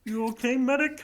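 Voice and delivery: high Kermit the Frog voice. Acted. Pyro checking on the medic, in a high frog voice. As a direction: ask it like the Muppet frog.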